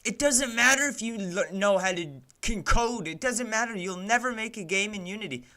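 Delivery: high, loud exasperated rant at the engine; angry rant. Real, not acted.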